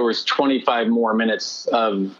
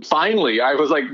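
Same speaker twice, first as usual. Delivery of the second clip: ordinary talk, nothing marked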